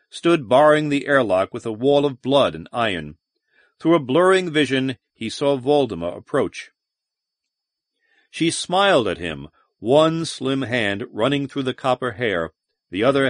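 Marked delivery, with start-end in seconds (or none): none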